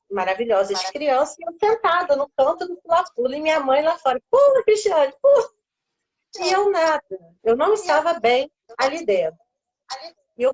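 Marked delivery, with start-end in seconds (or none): 4.3-5.5 loud, high call to jump; a mom shouting encouragement from outside the bouncy castle; mother calling out. Retold or imitated. Mother urging daughter from outside.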